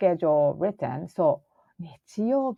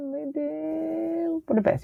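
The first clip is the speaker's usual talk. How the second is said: high squeaky voice; 9 semitones higher, 4 dB quieter than usual. Acted. Voicing the other side of a question.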